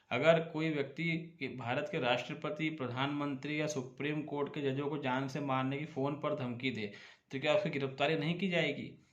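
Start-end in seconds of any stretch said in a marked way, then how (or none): none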